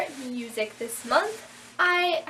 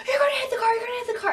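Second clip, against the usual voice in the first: fast, much higher, loud mock panic; mock screaming examiner; 11 semitones higher, 4 dB louder, much faster than usual. Examiner yelling as she backs up, fast mock panic. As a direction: rattle it off in a shrill panic. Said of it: "he was just yelling".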